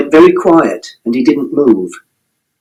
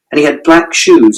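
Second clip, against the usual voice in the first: usual speaking voice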